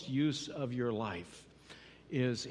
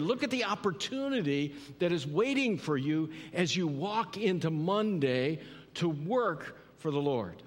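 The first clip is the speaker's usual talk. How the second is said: usual speaking voice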